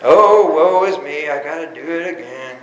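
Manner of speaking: big mopey donkey groan, fading off; Eeyore voice. Retold or imitated. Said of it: "Eeyore, the donkey, right?".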